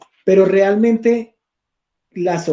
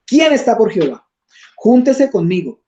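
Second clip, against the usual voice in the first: higher rallying call, read aloud; 5 semitones higher, 4 dB louder than usual. Acted. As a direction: summon the people as a leader. Moisés convoca al pueblo desde la puerta. (llamando al pueblo, con tono más alto)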